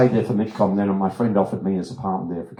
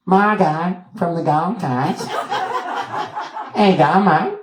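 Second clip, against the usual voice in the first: very slow, high Aussie drawl; Margot, broad Australian accent; 10 semitones higher, 4 dB louder, much slower than usual. Retold or imitated. Repeating her name for the director, broad Australian. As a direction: drag out each Australian vowel, high. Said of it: "wrong accent".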